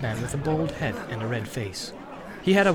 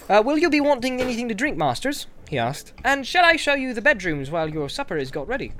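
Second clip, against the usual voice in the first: much higher, quick hobbit reply from afar; eager servant; 8 semitones higher than usual. Acted. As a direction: call back hurrying. Servant serves guests.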